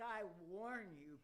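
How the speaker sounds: normal talking voice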